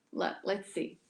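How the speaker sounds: usual speaking voice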